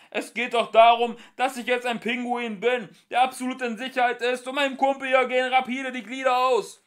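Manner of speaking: loud, high, fast angry rant; furious rant. Acted. Ford ranting at the ship's voice, loud and furious.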